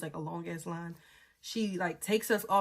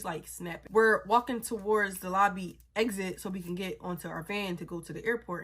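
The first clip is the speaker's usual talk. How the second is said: ordinary delivery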